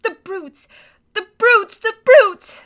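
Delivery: high, rising to a loud sob; tearful outcry. Acted. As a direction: build from grief to fury. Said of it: "tears streaming down her cheeks".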